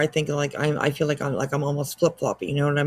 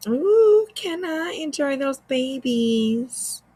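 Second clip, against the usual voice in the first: delighted; 8 semitones higher, much slower than usual. Real, not acted. Savoring a snack.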